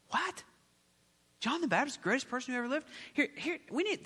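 high-pitched incredulous what; a preacher's exaggerated shock; mock astonishment. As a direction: play up the disbelief for the crowd.

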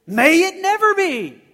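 high, loud proclamation; reading a Bible translation with big emphasis; booming reading. Shown as a demonstration. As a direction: declare it grandly. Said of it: "what do some of the other translations say".

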